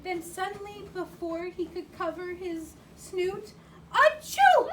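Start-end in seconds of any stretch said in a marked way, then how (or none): 3.9-4.7 loud, high fake sneeze; big sneeze. Reading to child, the flea sneezes loudly. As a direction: burst out in a huge achoo. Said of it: "Went the flea".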